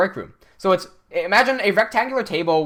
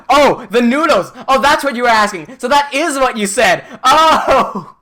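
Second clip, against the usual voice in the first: laughing realization; 7 semitones higher, 8 dB louder, slower than usual. Retold or imitated.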